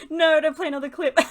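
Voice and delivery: real laughing objection; laughing protest. Real, not acted. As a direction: protest while cracking up. Laughing protest as player continues.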